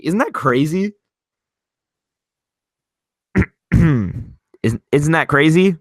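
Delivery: normal talking voice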